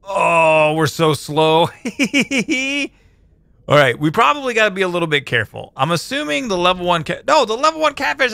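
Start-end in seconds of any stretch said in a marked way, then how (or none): 1.7-2.9 cartoon laugh. Real, not acted. Laugh alone after being slow.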